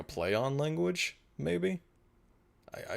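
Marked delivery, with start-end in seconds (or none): 0.0-1.8 high, tentative questioning lilt; sheepish half-question; unsure. Real, not acted. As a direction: hedge it as the pitch climbs. Admitting a doubt about Pig Latin.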